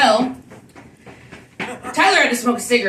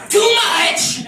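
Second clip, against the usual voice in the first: high, loud mock shout; imitated outburst; 10 semitones higher, 6 dB louder than usual. Roaster imitating a friend losing at dice, mock shout. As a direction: imitate a frustrated gamer shouting. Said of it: "I've never seen somebody like".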